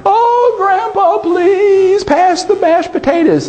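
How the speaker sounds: put-on very high, sing-song wailing child voice; hammed-up weepy little girl asking twice; granddaughter crying. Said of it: "his granddaughter starts crying".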